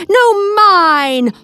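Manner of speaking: hollered. Acted. Squirrel hollering back at his rival for the cone. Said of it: "hollered Bruce".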